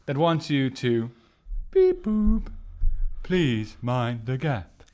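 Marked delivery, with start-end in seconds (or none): 1.7-2.5 robot beep. Pretend. Mock robot noise intro. 3.2-4.8 slow, low announcer voice; recorded station warning, slow; train station announcement. Pretend. Doing the thing again.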